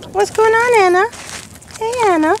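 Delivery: high, sing-song voice for a dog; talking to the dog in a high voice; high pet voice. Real, not acted. Cooing at the dog.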